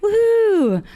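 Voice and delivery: loud, high woohoo; high-pitched whoop to the crowd; celebratory whoop. Real, not acted. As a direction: let out a piercing party cheer. Cheers for ladies' turn.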